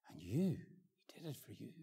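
breathy unvoiced whisper of "he did it for you". Preacher to one listener, a hushed whisper. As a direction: lean in and whisper it. Intimate plea.

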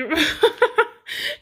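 bursting into giggles; high-pitched laugh. Real, not acted. Laughs after the intro flubs.

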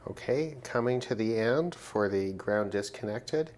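normal talking voice

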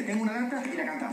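high, straight-out demonstration of giving a tip; impatient demonstration. Shown as a demonstration. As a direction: show how to just say it. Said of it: "la data se dice". (demostración impaciente, en voz aguda)

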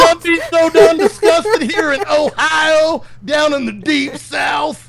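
hammed-up Southern drawl, shouted high and slow; southern twang shout. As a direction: bellow it like an angry Southerner. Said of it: "turn off the twang".

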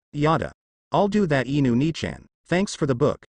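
usual speaking voice

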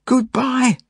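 high, desperate farewell shout; yell. A narrator's reading. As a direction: shout a farewell over the din, pitched high. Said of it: "I yell".

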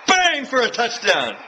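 loud, high, slow hammed-up sportscaster call; drawn-out mock play-by-play shout; mock commentator. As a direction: belt it like an over-the-top announcer. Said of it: "commentating football".

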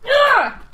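sudden very high loud Ah; sharp startled yell; startled shriek. Real, not acted. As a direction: yelp in real surprise. Sudden scream while recording.

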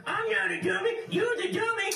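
high squeaky puppet voice, shouting back; cheeky high-pitched dummy; squeaky ventriloquist dummy. Acted. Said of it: "This is my ventriloquist dummy".

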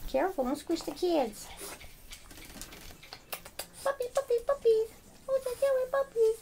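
high sing-song puppy talk; high cooing pet voice; baby talk to puppies. Acted. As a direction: coo at the dogs in a high voice.

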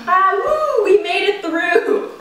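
high happy cheer. Real, not acted. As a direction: celebrate the finish. Celebrating end.

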